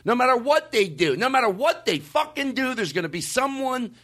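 heated insistence rising high; emphatic building rant. Real, not acted. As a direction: drive the point harder each time. Emphatic repeated point, rising heat.

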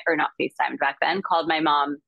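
ordinary delivery

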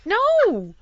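high, loud mock protest; hammed-up exasperated no; emphatic refusal. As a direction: refuse at full pitch, half laughing. Acted refusal to mother.

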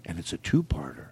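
breathy whisper of the catchphrase. Acted. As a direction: drop to a whisper.

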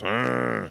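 rough little growl in place of words; mimicked dismissive growl; imitated grunt. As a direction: grunt back like an unfeeling kid. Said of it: "Isla just goes".

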